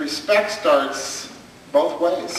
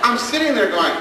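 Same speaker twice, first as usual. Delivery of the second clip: laughing high; 8 semitones higher, slower than usual. Real, not acted. Realizing horror, laughing.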